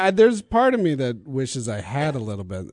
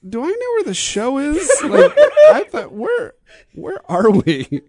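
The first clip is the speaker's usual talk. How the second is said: high-pitched mock confusion; acted bewilderment; 12 semitones higher, 5 dB louder than usual. Comic telling of being lost looking for the show, acted bewilderment. Acting out own confusion about location.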